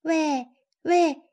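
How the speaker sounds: high, loud call across to the tiger. A narrator's reading. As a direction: call out to a neighbour's house. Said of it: "艾玛叫道".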